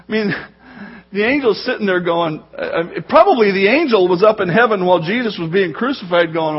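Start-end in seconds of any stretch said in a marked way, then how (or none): none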